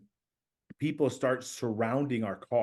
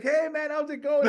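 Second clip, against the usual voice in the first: much higher, bright-voiced mock hello; friendly greeting; 4 dB louder than usual. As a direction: greet the strangers like old pals. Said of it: "And I'm just like".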